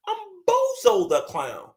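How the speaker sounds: high, slow, hammed-up Bozo line; goofy high clown announcement; mock clown voice. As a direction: land the joke in a silly high voice. Mock outrage punchline.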